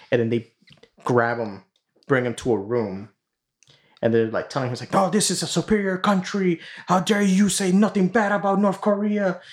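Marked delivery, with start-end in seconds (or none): 4.9-9.4 much higher, indignant mock-soldier voice; berating soldier. Soldiers lecturing the wrestler in a room, high indignant mock scolding. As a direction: scold him like an outraged guard.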